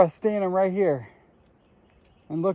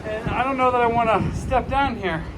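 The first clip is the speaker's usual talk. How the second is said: loud and higher, raised over wind; shouting over wind; 4 semitones higher than usual. Real, not acted. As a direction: call out over a roaring wind. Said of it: "I'm gonna talk loud 'cause it's windy here".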